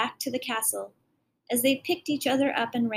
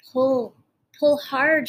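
urgent cry; 3 semitones higher, 6 dB louder, slower than usual. Acted. Girl urges prince to tear shadow free, urgent loud cry. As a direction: urge him to pull hard, calling out. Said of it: "she cried".

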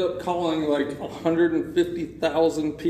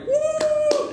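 very high mock whoop; imitated cheer. Retelling background cheer in the scam center, high whoop. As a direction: whoop like the call center crowd.

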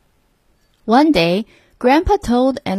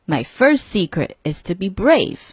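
plain reading voice

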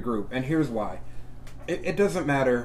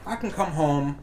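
ordinary talk, nothing marked

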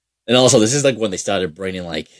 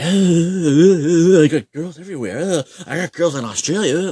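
mocking the bragging goalie; 9 semitones higher, 5 dB louder than usual. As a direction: stretch the uhs like a dim show-off boasting. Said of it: "just talking shit the whole time".

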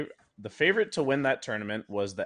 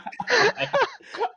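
high helpless laugh; 3 dB louder than usual. Real, not acted. Laughs at own story.

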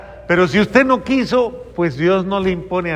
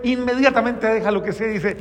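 normal talking voice

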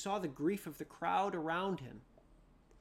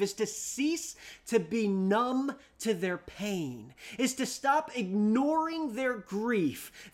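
louder, rising preacher's cry; fervent preaching; 5 semitones higher, 12 dB louder than usual. Acted. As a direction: drive the point home to the congregation. Preaching at full force.

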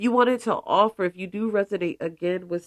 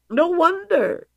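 high emphatic repeat; 11 semitones higher, 4 dB louder than usual. Real, not acted. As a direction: say it again way higher.

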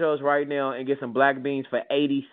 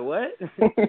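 higher, startled "What?"; baffled what; 5 semitones higher than usual. Retold or imitated. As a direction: thrown off by a strange question. Confused by offer of gold.

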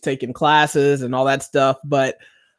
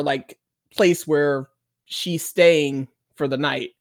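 ordinary delivery

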